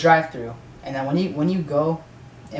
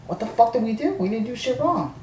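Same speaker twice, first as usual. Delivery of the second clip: higher, outraged disbelief; indignant protest; 8 semitones higher than usual. Retold or imitated. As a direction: protest your innocence, offended. Arguing with worker about leaving.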